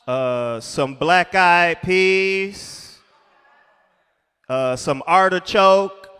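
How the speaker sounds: slow, hesitant list with loud ums. Retold or imitated. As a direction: drag out the menu awkwardly. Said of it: "[name] be like".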